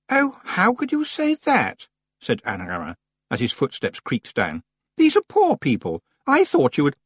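scandalised and offended; indignant prissy girl. Acted. Anagramma shocked and indignant.

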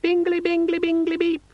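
very high, squeaky alarm chirp; cheerful gadget demon. A narrator's reading. Pocket organiser demon sounding its alert, cheerfully. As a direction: chirp like a cheerful little device. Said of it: "said a cheerful voice from his pocket".

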